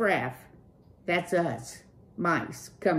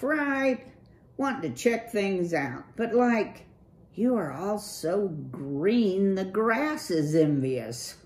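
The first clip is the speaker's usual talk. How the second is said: higher, loose slacker-dude voice; Clutch, cool dude; 5 semitones higher, 5 dB louder than usual. Acted. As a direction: play the laid-back streetwise rocker. Narrator voices Clutch the rat.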